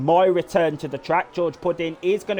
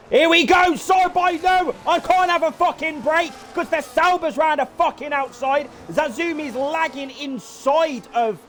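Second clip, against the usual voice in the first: excited shouting; 10 semitones higher than usual. Real, not acted. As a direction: shout it out, all worked up. Excited outburst at race chaos.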